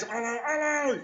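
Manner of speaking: a hint of a British child; British kids hello. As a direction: chirp it like startled kids. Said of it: "Then their kids".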